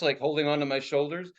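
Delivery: usual speaking voice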